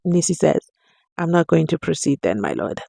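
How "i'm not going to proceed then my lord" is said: muttered under breath. Retold or imitated. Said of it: "Under his breath".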